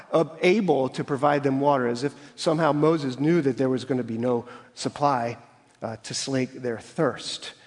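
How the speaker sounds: ordinary talk, nothing marked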